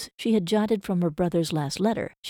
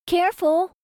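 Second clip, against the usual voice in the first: high, loud warning call across the platform; 11 semitones higher, 5 dB louder than usual. A narrator's reading. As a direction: call out sharply to stop them. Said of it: "she called out".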